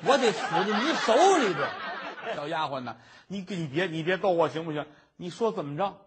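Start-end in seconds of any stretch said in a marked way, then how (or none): 0.0-3.0 loud laughing groan at being teased; a scholar laughing in loud exasperation at the maid's joke; exasperated laugh. Acted. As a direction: laugh it out, half scolding.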